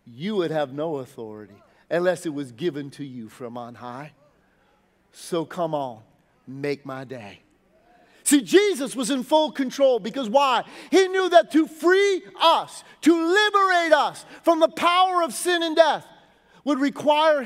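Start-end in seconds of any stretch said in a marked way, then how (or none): 0.0-7.4 low, softer, unshaken calm. Retold or imitated. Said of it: "looked at him so calmly and said". 10.9-17.4 loud, higher preaching surge; preaching climax. Real, not acted. Preaching at full force.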